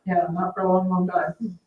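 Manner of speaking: usual speaking voice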